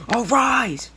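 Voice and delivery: high, drawn-out mock yell of "Arise!"; acted high-pitched summoning cry; yelled command. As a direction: call it out as a summoning, pitch leaping up. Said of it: "he yells it out".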